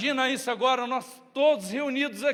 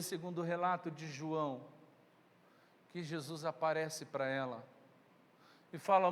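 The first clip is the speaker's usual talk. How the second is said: ordinary delivery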